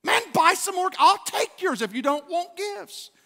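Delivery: high, incredulous protest; irritated disbelief. Retold or imitated. To folks skipping Christmas gifts, irritated disbelief. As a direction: protest in exasperation, pitch way up. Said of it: "it always irritates me".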